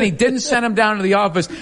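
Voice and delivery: ordinary talk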